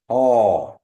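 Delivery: "Oh" with a falling tone, drawn out; teacher models a falling tone. Shown as a demonstration. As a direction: let "Oh" drop and lengthen. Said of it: "that tone is going down".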